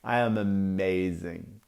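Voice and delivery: a hint of Italian accent, slow and soft. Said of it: "he's a bit more Italian sounding than that".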